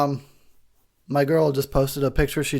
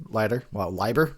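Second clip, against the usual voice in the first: usual speaking voice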